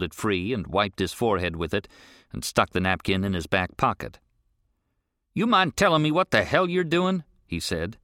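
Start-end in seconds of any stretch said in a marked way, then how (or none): 5.3-7.2 put-on older rancher, higher and pushier; gruff rancher. Rancher questions the boy on the road, irritated. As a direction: demand an answer, irritated.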